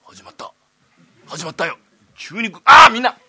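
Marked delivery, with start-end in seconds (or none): 2.2-3.2 alarmed shout. Acted. Tenant warns everyone to duck as the master starts singing, loud alarmed shout. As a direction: bellow a warning to get down.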